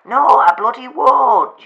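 loud, high, slow and huffy "No, I bloody won't"; indignant retort. Acted. Telling the waiter off, loud and high-pitched. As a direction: bristle at being called old, loudly.

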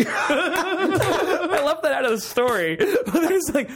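high laughing talk. Real, not acted. Telling friends the story, laughing high. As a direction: giggle through the aside.

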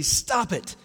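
a mild mock yell at the wind; yelled command. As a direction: call it out as a sharp order. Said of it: "yells at the wind and sea".

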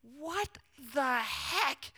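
hushed, high, drawn-out words; stunned disbelief. Retold or imitated. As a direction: let each word hang in acted shock. Stunned reaction to the amount.

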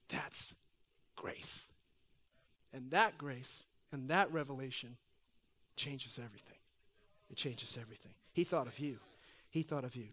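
very soft, low preaching; hushed reverence. Real, not acted. Preacher telling congregation that God thought of them, hushed. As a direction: let the revelation land quietly. Hushed sermon climax on grace.

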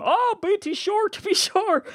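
put-on high, drawn-out stage Irish accent; Troy Aikman's mock Irish accent. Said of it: "imagine doing that in any other accent".

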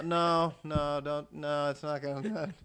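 half-hearted imitation of the director cutting in; mildly mock director. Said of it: "They said".

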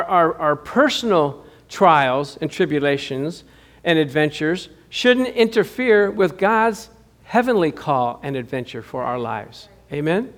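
ordinary talk, nothing marked

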